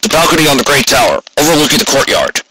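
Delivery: lightly voiced guard, fast; a hint of a brisk guard giving directions. A narrator's reading. Guard answers Rainbow.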